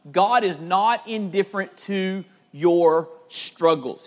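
slow, deliberate preacher's emphasis; slow emphatic repeat. Real, not acted. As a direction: spell out the key point slowly, word by word. Key point repeated for emphasis.

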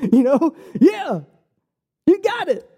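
high-pitched, tickled concession; amused agreement. Retold or imitated. Preacher reacts to his buddy's reminder, high and amused. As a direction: send it way up in pitch, playfully conceding.

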